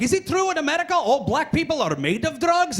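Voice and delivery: put-on high-pitched Croatian-accented question. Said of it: "they end up saying stuff like".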